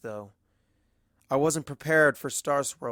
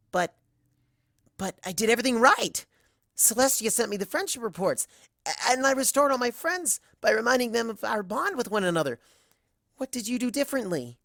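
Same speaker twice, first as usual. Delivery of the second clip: higher, louder and insistent; upset protest; 10 semitones higher, 4 dB louder than usual. Acted. Other Twilight insisting to Twilight she did everything right, high and pleading. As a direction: defend yourself, rising in dismay.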